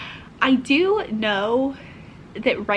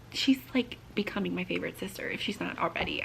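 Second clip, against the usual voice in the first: whisper; 4 semitones lower, 8 dB quieter, faster than usual. Real, not acted. Quiet aside about her sister.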